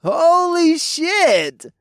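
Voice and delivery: high, slow nasal yell across a room; nasal shout. A narrator's reading. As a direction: call out in a nasal frat bro voice. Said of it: "a nasally voice called out".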